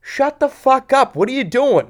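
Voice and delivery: high, loud mock cop rebuke; exasperated retold shout; scolding cop. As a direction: imitate a cop telling someone off. Acted cop scolding the yelling man.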